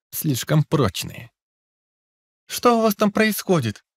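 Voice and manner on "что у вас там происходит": lightly voiced sweet young girl; a hint of a melodic girl's call. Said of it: "мелодичный девичий голосок".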